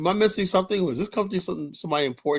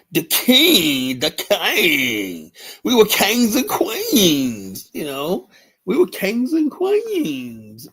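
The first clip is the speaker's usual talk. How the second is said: mock royal oration; 6 semitones higher, 4 dB louder, much slower than usual. Mock-grand speech about African royalty.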